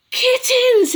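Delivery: high, loud delighted shout; exclaiming. A narrator's reading. Farmer Big discovers the cat's kittens, high loud delighted exclaim. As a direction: burst out in glad surprise. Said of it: "exclaimed Farmer Big".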